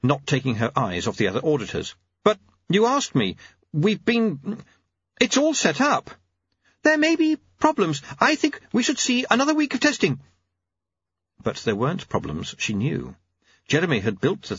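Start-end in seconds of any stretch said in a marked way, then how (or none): 2.2-6.1 high, flustered clockmaker; bewildered high-voiced inventor; Jeremy. 6.8-10.2 high-pitched formal protest; Lady Lejeune. Acted. Voices Lady Lejeune stalling.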